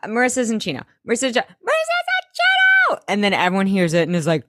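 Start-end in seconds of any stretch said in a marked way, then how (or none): 0.1-0.8 higher voice at normal volume; slightly high, contained nervous admission; contained confession. Retold or imitated. Seth's first, contained attempt. 1.1-3.0 very high squawked outburst; hammed-up high-pitched yell; mock yelled blurt. Seth blurts it out to all.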